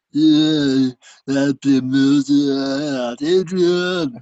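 loud, slow, mumbled Rocky voice; Stallone impression. Joke teller voicing Stallone, loud and slow. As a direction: drag it out like Rocky calling Adrian. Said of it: "Sylvester Stallone says".

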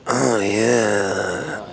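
low, drawn-out mock seductive Oh yeah; Dick Power impression. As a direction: croon it low like a cheesy lover. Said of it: "I'm gonna pull a Dick Power over here and go".

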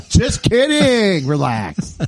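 high, loud, drawn-out disclaimer; playful backpedal. Real, not acted. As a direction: stretch it out loudly to wave off the joke. Taking back the wife joke.

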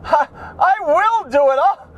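high, quick, hammed-up girly voice; mock eager volunteer. Comedian voices a plus-size model offering to wear a bikini, high and giddy. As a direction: play it like a giddy model begging to pose.

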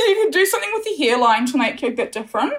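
playful laughing dig at the cohost; laughing tease. Real, not acted. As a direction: tease him while laughing. Teasing cohost through laughter.

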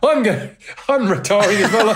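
laughing through the words; chuckling, high and amused. Retold or imitated. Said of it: "He said".

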